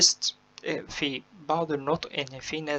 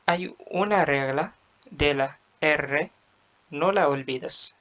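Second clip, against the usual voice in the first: slow recitation; much slower than usual. Shown as a demonstration. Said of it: "teacher reciting the rule slowly".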